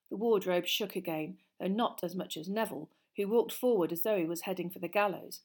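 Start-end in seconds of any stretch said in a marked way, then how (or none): none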